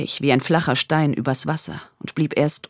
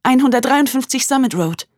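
tense; 9 semitones higher, 4 dB louder than usual. Acted. Grace gives address to police.

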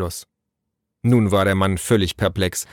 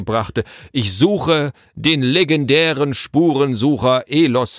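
higher, declamatory herald voice; proclaiming messenger; 6 semitones higher, 3 dB louder than usual. A narrator's reading. Messenger listing the tracker's titles at the door, grandly proclaiming. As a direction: proclaim each title with pomp.

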